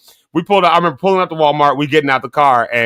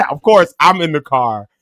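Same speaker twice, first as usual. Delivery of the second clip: ordinary talk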